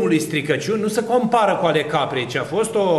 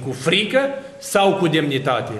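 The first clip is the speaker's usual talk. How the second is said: ordinary talk, nothing marked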